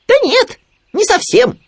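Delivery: very high, loud little animal; Khryunya objecting. A narrator's reading. Khryunya contradicting Owl, high piping voice. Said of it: "возразил Хрюня".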